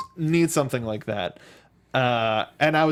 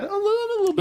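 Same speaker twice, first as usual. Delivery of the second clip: tiny squeak, much higher; squeaky high voice; much faster than usual. Acted. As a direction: pinch it up into a squeak. Mock understatement, squeaky high.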